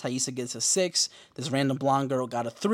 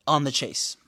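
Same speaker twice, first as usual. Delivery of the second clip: ordinary delivery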